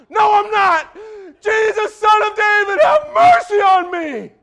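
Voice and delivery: high, loud shouted plea. Acted. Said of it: "you're too loud".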